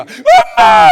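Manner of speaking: daughter-in-law putting on a show of grief; theatrical wail. Shown as a demonstration. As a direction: fake "엄마!" cry, very high and loud. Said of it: "그런 걸 연극이라고 그럽니다".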